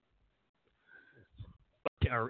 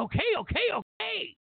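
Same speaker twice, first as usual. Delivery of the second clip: high-pitched, repeated giving in; mock flustered surrender; 13 semitones higher than usual. Badgered voter giving in to pushy friend, high mock surrender. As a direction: cave in under badgering, pitch way up.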